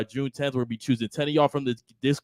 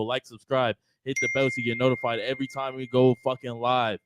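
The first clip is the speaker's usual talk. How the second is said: usual speaking voice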